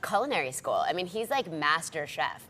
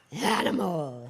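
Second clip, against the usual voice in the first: Animal voice; much slower than usual. Pretend. Mock host cries out name.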